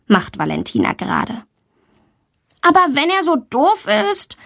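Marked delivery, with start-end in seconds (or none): 2.6-4.4 put-on high-pitched protesting child; defensive. A narrator's reading. Valentina to her teacher, defending herself. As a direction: argue back in a high voice. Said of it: "verteidigt sie sich".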